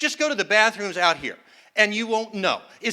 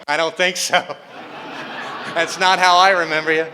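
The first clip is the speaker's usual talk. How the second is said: smug phone voice. Retold or imitated. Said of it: "And it's going".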